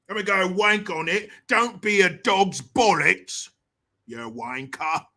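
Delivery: exaggerated British slang in a Cockney accent; mock British accent. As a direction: put on a joking Cockney insult. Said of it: "making fun of these damn accents".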